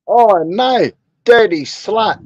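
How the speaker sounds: loud, high mock outrage; laughing disgust. Real, not acted. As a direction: react with loud laughing shock. Reacting to lewd army story.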